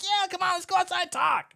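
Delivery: put-on a flattered drunk who thinks he's special; imitating the patron, keen to go; eager, self-important. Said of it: "He feels important".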